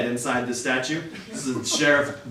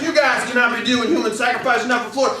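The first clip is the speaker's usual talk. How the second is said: sheriff quote; 7 semitones higher than usual. Retold or imitated. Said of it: "And the sheriff was like".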